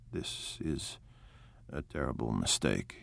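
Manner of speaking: a low secret confession of dread; hushed dismay. A narrator's reading. Gatsby hushed in the kitchen.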